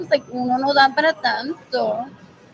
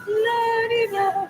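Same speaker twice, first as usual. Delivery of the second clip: higher sing-song mockery of a careless reply; mocking la-di-da; 8 semitones higher, slower than usual. Mimicking people who shrug off her complaints, sing-song mock. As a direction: toss it off airily, mocking them.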